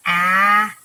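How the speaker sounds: loud open ah; teacher modelling ah; short a sound. Shown as a demonstration. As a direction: sound out ah clearly. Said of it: "I'm going to say each sound".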